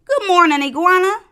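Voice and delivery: high, singing snake greeting; friendly python. A narrator's reading. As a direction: greet in a high, lilting character voice. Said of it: "The big snake raised his head and said".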